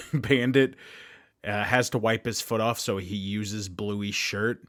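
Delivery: ordinary talk, nothing marked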